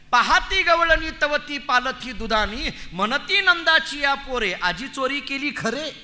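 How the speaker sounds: slow, drawn-out devotional verse; abhang recitation. Acted. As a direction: recite the verse slowly, stretching the phrases.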